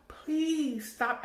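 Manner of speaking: mock yell at the kids to stop; a retold scream at the top of her lungs. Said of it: "at the top of my lungs".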